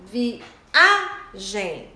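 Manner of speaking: "viagem" split, with a big A; syllable by syllable "viagem", strong on A; stressed syllable. Shown as a demonstration. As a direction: hit the middle A of "viagem". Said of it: "tem mais intensidade".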